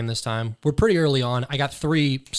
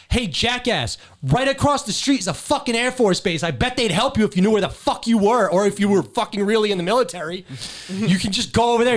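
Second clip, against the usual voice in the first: higher, louder telling-off of a fake veteran; retold angry yelling; 8 semitones higher, 4 dB louder than usual. Airman yelling at a fake veteran outside a gas station, angry yelling. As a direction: chew him out like you caught a liar. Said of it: "And I fucking go".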